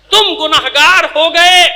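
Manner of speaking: high, loud, drawn-out rebuke; forceful accusation. Real, not acted. Preacher blames the mourners for the wrong prayer, loud slow accusation. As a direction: slowly hammer the accusation home. Accuses listeners of having sinned.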